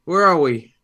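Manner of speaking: high, loud bewildered question; lost and alarmed, asking aloud; confused father. Acted. Father asking his son where they are, alarmed. Player character disoriented.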